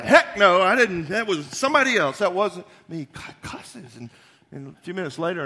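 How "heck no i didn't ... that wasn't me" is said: much louder cursing denial; angry denial. Acted. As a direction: spit out a furious denial. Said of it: "So he's like".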